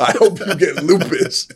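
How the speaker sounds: retelling the stranger's curse to his cohost, hammed-up; imitating the curse. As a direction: savour the curse like comedy gold. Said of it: "It's all about delivery".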